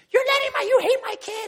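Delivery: loud, very high-pitched accusation; hammed-up high, loud outburst; imitated angry yelling. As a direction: act out an irate parent yelling at a coach. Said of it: "people just come up and yell at you".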